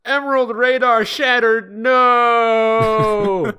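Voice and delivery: high, loud anguished cry; dramatic Knuckles. Acted. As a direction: wail over the broken radar.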